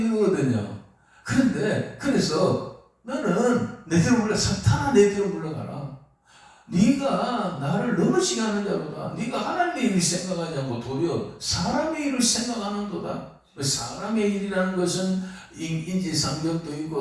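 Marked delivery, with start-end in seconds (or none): 3.0-13.3 raised, commanding rebuke, pitched higher; Jesus rebuking. Acted. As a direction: cast him back with authority. Jesus rebukes Satan in Peter. (베드로에게 사탄아 물러가라 꾸짖는 예수님, 높고 단호하게)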